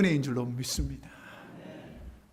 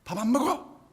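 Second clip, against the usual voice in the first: mock childish defiance, pitched up; imitating a stubborn kid's protest; sulky child refusal; 5 semitones higher, 4 dB quieter than usual. Young son refusing dinner, mock sulky shout. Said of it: "제가 하는 일이 뭘까요?".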